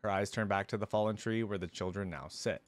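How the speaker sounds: ordinary delivery, nothing marked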